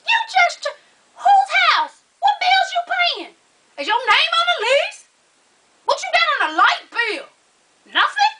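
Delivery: very high, incredulous rapid-fire challenge; mock outraged parent. Parent grilling a daughter about bills, mock outraged. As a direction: fire off questions in squealing, hammed-up indignation.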